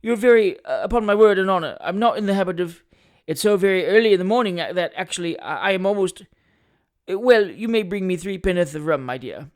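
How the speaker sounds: halting mock-reluctant acceptance; pompous attorney, flustered. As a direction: protest coyly, then give in. Attorney coyly accepts a drink.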